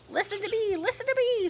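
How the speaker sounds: high, pleading goose voice; insistent goose. A narrator's reading. Goose begging Wilbur to listen, high. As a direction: demand his attention. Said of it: "said the goose".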